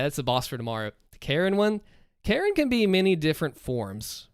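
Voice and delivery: ordinary delivery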